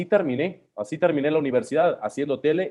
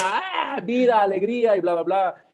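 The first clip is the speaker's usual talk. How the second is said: high, overexcited imitation of an eighties radio announcer; mock hyped radio host; 6 semitones higher than usual. Mocking the old shouty commercial radio style, high and hammed-up. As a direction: ham up the cheery commercial-radio cry, much higher. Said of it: "donde todo mundo gritaba".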